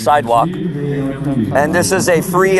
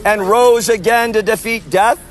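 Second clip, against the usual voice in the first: steady raised preaching voice; street preaching; 6 semitones higher than usual. Real, not acted. Street preacher calling out to festival goers, preaching. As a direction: proclaim it to the crowd.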